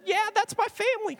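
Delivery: high put-on voice; mock audience member. One person claiming the big family, high mock voice. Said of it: "The one person said".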